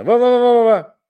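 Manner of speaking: loud high wah wah babble; muffled wah wah talk. Retold or imitated. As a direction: shout a string of wah wahs. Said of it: "это учение".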